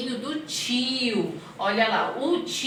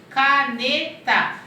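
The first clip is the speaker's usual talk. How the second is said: "caneta" slow and louder, split into three beats; syllable by syllable; 4 semitones higher, 5 dB louder, much slower than usual. Shown as a demonstration. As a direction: stretch "caneta" out for children. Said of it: "eu separei em três".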